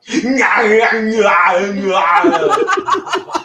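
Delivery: loud, high strained Schwarzenegger yell; mock Arnold scream from Total Recall. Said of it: "And this is it. Ready?".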